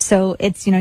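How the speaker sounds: normal talking voice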